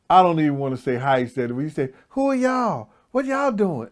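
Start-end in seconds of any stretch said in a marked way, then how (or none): 2.1-3.9 mock womanish voice, pitched way up; imitating him in a high-pitched, womanlike voice; high-pitched effeminate voice. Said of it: "in a high-pitched voice".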